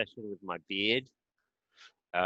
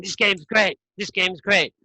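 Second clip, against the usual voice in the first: enthusiastic fan; 9 semitones higher, 4 dB louder, slower than usual. Retold or imitated.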